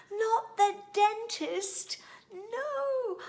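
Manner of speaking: high, loud panicked no; shouted protest. Acted. Child shouting at mum not to fetch the dentist, high and loud. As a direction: cry out in horror at the idea. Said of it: "I shouted".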